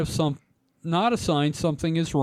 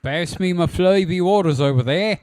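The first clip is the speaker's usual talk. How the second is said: mock Australian; 3 semitones higher, 5 dB louder than usual. Joking Australian voice.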